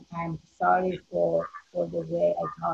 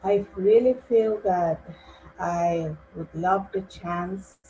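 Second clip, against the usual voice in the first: speaking up. Real, not acted. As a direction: speak up so they can hear you. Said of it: "can you speak up?".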